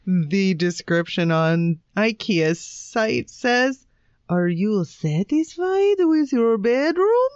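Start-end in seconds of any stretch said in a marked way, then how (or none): 4.3-7.3 bad Swedish accent, reading ad copy; attempted Swedish accent. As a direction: sell it in a dodgy Swedish accent. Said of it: "This is your best Swedish accent".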